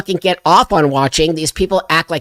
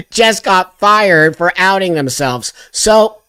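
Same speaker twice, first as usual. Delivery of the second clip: ordinary delivery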